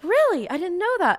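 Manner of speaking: high, hammed-up polite surprise; mock surprised. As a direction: play the idol humoring an odd fan. Trixie's reply, voiced high.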